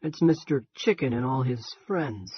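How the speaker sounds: lazy Western drawl, taking his time; John Wayne drawl. Acted. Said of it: "in a passable John Wayne drawl".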